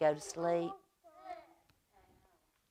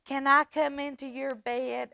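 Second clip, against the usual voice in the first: small pleading voice; 7 semitones higher, 4 dB louder than usual. A narrator's reading. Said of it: "asked Little Mouse".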